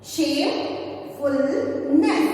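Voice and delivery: Shamefulness said very slowly, rising; slow syllable by syllable. Shown as a demonstration. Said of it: "we are gonna pronounce it?".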